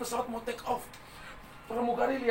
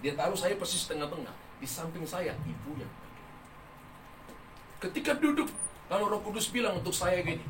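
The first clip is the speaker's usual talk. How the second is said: ordinary talk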